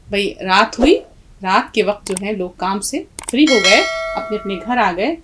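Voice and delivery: ordinary talk, nothing marked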